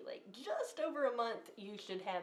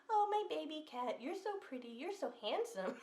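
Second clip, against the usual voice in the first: put-on higher, cooing baby talk; baby talk to a cat; 8 semitones higher than usual. Talking to herself, cooing at her pretty cat in higher baby talk. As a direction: coo at the cat like a pet owner.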